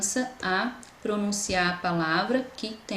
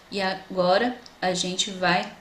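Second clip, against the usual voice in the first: ordinary talk, nothing marked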